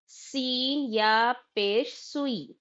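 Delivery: सीन या पेश सुई spelled out slowly letter by letter; teacher drawing out each letter name of सीन या पेश सुई; slow model syllables. Shown as a demonstration. Teacher models spelling for students, slow and deliberate. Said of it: "सुन के practice करिए".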